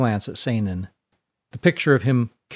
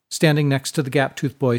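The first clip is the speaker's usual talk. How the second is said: ordinary delivery, nothing marked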